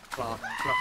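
silly high plop. Real, not acted. Imitating noise.

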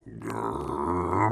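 deep low growl; tiger growl. Acted. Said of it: "they could only say".

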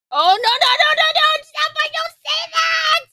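high, loud no-no-no protest; pleading shout. Real, not acted. Fan yelling at the characters not to deny it, high and loud. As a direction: yell at the screen to stop them.

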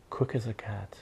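ordinary delivery, nothing marked

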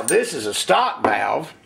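usual speaking voice

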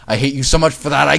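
put-on loud childish outburst; acted angry fit; tantrum. A narrator's reading. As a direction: blurt it out loudly in a tantrum. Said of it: "threw a tantrum".

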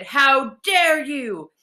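slow, high outraged troll shout; indignant monster, drawn out. A narrator's reading. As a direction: bellow it, stretching each word. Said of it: "the troll shouted".

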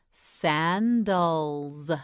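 "Sandals" very slow, each syllable drawn out; stretched out. Shown as a demonstration. Teacher models for learner to try, "Sandals" slowly stretched. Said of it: "You try it".

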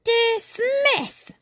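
high, drawn-out drill command; shouted dismissal. A narrator's reading. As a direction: call it across a parade ground. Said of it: "the horseshoe crab shouted".

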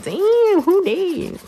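high, impressed exclamation; high mock-impressed exclaim; impressed damn. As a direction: catch sight of a cute guy. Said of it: "I did tell my friend, like".

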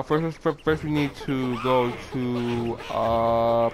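usual speaking voice

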